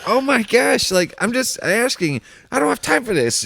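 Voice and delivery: high, exasperated mock complaint; exasperated worker. Worker fed up with a caller, high exasperated voice. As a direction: sigh it out like you're fed up. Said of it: "it's like".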